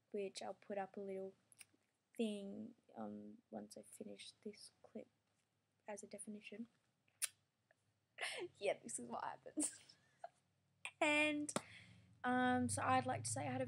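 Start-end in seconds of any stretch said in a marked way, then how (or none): 0.1-9.7 hushed whisper so mum won't hear; quiet whisper beside someone. Real, not acted. Editing right next to mum.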